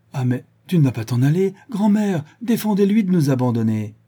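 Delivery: loud, high-pitched playful appeal; pleading protest. Acted. Bijou begs grandmother to keep him from leaving, loud protest.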